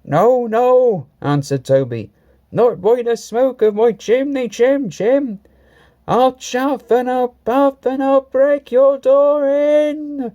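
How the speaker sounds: mock-brave Toby. A narrator's reading. Toby shouting back through the door at ghost Percy. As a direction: puff up and defy the ghost.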